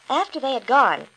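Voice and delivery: ordinary delivery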